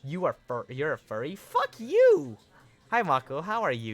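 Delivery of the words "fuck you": high, loud indignant yell; outraged shriek. Real, not acted. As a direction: shriek it at the chat in mock outrage. Reacting to chat calling her furry.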